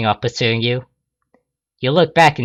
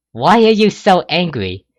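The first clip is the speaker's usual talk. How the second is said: higher, louder demand; upset; 7 semitones higher, 5 dB louder than usual. Acted. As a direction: push back at him. Rainbow pressing questions.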